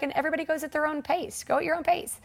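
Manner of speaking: high-pitched imitation of an instructor; lightly voiced coach's pep talk; spin instructor. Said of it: "the instructor busted me".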